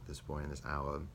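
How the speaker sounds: ordinary talk, nothing marked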